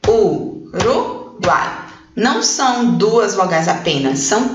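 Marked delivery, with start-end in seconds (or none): 0.1-1.8 very slow "Uruguai" split as u-ru-guai, with gaps; syllable by syllable. Shown as a demonstration. Teacher checking the vowels with pupils, "Uruguai" slowly syllable by syllable. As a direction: break "Uruguai" into three drawn-out syllables. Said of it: "Vamos ver?".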